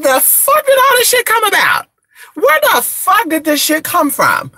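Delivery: high-pitched, incredulous outburst; exasperated disbelief. Retold or imitated. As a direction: blurt it out high, utterly baffled. Acted outburst at absurd witch labels.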